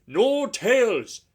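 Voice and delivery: loud, slow and firm. Acted. Old engine scolding the coaches, firm and loud. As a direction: lay down the law, slowly. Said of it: "said Skarloey firmly".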